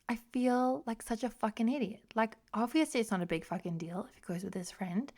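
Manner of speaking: hushed, half-whispered self-scolding; retold self-reproach. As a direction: replay scolding yourself under your breath.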